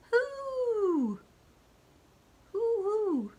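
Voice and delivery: high, loud hooting; owl hoot. Acted. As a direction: hoot like an owl, long then short. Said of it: "The owl says".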